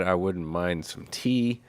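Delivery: ordinary delivery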